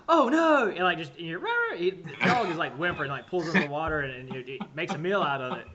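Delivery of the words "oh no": higher, louder "oh no" in a little dog voice; voicing the doomed dog, hammed up; dog's mock cry. Dog caught by the alligator, high loud panic. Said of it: "you hear him go".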